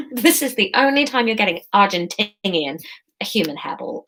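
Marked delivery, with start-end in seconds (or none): 3.2-4.0 low whisper; hushed aside. Real, not acted. Reader to chat in an aside, whispered. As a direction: drop to a quick whisper.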